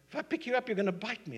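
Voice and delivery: high-pitched, lightly indignant protest; incredulous objection. Acted. Man wary of a snake asking to be carried, incredulous objection. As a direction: raise the pitch and object in disbelief.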